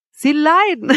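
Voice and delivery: high, loud question breaking into laughter; laughing. A narrator's reading. Woman mocking her suffering suitor, laughing. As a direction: laugh at the very idea. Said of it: "Sie lachte wieder".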